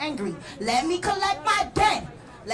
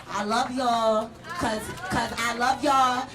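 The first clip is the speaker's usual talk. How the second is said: tender; 5 semitones lower than usual. Real, not acted. Closing, softly to crowd.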